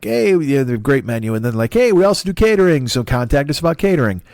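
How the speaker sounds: bright mock marketing voice; cheery website voice. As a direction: sell it like a chirpy restaurant promo. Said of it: "they're like".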